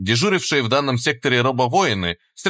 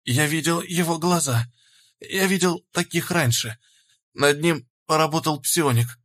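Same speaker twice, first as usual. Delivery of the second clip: hoarse dying voice; 5 semitones higher than usual. A narrator's reading. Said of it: "прохрипел тот".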